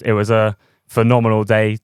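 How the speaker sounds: ordinary talk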